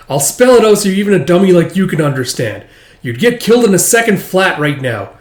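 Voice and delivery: loud, high, cutting lecture; harsh scolding. Acted. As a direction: talk down to her hard. Alma scolds the girl.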